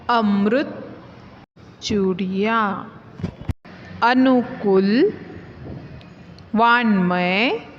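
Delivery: slow, clear, one word at a time; word list recital. Shown as a demonstration. Teacher reading vocabulary aloud to students, slow and clear. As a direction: read each word out for the class to repeat.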